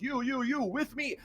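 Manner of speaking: lightly voiced guard picking out his men; guard giving orders.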